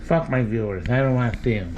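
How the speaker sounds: low grumpy cat voice, dropped well down; gruff put-on voice for the cat; voicing the cat. Owner speaking for the grumpy cat.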